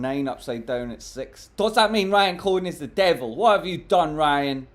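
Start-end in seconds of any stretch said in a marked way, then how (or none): 1.6-4.7 loud, higher hammed-up accusation; mock outrage. Streamer jokingly accuses the CEO to viewers: loud mock outrage. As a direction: play up fake horror at Ryan.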